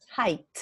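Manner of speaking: "height" closed with a clear T; final T. Shown as a demonstration. Teacher gives the other word, "height" with final T. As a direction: end "height" on a T sound. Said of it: "with a T in the end".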